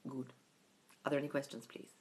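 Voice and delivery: a soft, hushed lecturer's close, trailing away; lightly voiced old lecturer asking for questions; Neville's hushed question. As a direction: imitate Neville ending the lecture gently. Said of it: "And then he goes".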